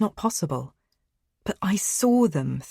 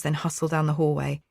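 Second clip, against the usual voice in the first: usual speaking voice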